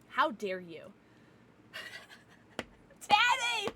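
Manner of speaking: ordinary talk, nothing marked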